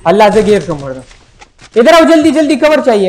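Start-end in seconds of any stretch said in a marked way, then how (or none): 1.8-3.1 urgent shout. Real, not acted. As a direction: shout for backup fast. Low health, calling for cover.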